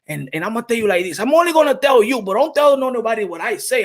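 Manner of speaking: normal talking voice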